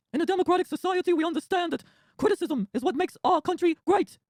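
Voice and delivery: loud, slow, far-off Brooklyn rasp; Bernie impression. Senator scolding Trump, loud slow Bernie rally voice. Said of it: "No, Bernie".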